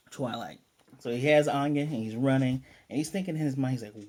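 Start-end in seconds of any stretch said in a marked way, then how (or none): none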